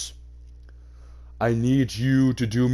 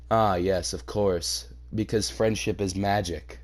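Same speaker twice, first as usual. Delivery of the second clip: sarcastic; 5 semitones lower, 6 dB quieter than usual. Real, not acted. Sarcastic aside on friendship.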